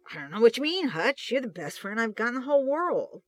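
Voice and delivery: put-on hearty denial; false reassurance. A narrator's reading. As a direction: cover the lie with brightness. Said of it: "false tone of his words".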